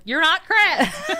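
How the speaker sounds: laughing mock scolding. Mom laughing as she corrects her son. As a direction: scold him while cracking up. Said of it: "And I'm like".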